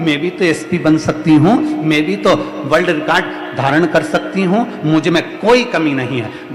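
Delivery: loud, rallying exhortation; forceful motivational preaching. Acted. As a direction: preach it loud to rouse the crowd.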